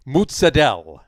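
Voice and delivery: "Mozzarella" as loud "moots-a-DELL"; Brooklyn Italian pronunciation. Shown as a demonstration. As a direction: punch out "Mozzarella" as moots-a-DELL, no final vowel. Demonstrating Brooklyn Italian food words.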